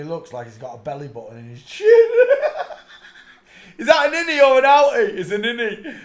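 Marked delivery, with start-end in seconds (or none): 1.9-3.7 high laughing burst. Real, not acted. Laughing at a face on screen, high squealing laugh. 3.8-6.0 high, loud words through laughter; laughing. Real, not acted. Joking to viewers about a chin, laughing loudly.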